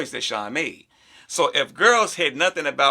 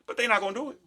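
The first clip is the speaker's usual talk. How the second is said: ordinary delivery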